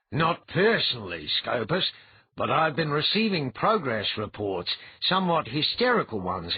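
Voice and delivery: a dry, wry high priest; high-voiced old priest. A narrator's reading. Yodon character voice.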